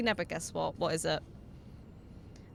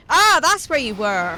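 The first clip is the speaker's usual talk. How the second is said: surprised shout; 6 semitones higher, 13 dB louder than usual. Real, not acted. Finding the enemy in game.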